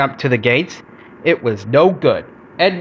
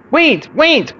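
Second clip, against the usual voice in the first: high, sharp calls to wait; urgent cry; 12 semitones higher, 3 dB louder than usual. A narrator's reading. Bertie reaches the yard as Edward leaves, crying out. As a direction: cry out to stop someone leaving. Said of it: "cried Bertie".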